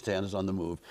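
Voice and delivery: low, hushed crew relay; film crew announcing. Retold or imitated. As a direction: report it low and soft, like set chatter. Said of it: "and they're, they go".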